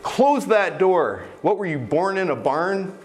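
a hint of an exasperated mother at the door; scolding parent. Parent scolding a child for leaving the door open, mock stern. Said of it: "saying things to me like".

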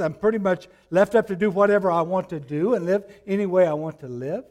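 rising sermon fervour; preaching at full force. Acted. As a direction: press the question home, fast on the middle. Rhetorical challenge to congregation.

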